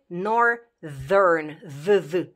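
"northern" split slowly, with a buzzed "th"; voiced th. Shown as a demonstration. Teacher demonstrating the sound change, slow voiced th. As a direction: stretch "northern", then isolate the voiced "th". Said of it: "cu d, d, d cu limba între dinți".

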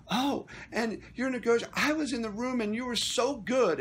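high, gushy mock flattery; mockingly fawning, pitched well up; pandering. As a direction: imitate a suck-up buttering up the board. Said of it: "starts pandering to this board, like, just stroking them".

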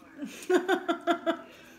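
breathy burst of laughter; real laugh. Real, not acted. Amused by talk of what's on Twitter, laughing. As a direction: laugh out loud without words.

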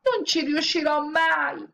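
high, slow, wailing despair. A narrator's reading. Woman weeping over the bones, despairing. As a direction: moan it out hopelessly. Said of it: "si mise a piangere disperata".

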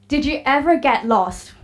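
usual speaking voice

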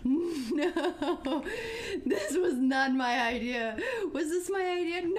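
whiny, near-tears laughter; half crying, half laughing in dread; panicked laughing protest. Real, not acted. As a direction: protest through frightened laughs, pitch climbing. Panicked protest amid nervous laughter.

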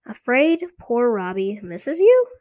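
a taunting puppet question, rising at the end; Slappy. A narrator's reading. Dummy taunts the sister, lightly squeaky puppet voice. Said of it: "Lindy made Slappy asked".

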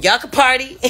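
high, loud release to the room; telling the room to party again; retold cheerful call. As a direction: announce it like a host. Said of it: "I told the rest of the room".

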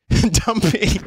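high, laugh-filled reading. Real, not acted. Streamer reads unflattering nickname aloud, laughing. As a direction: burst out laughing on the word.

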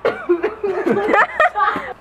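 burst of laughter. Real, not acted. Laughing during filming.

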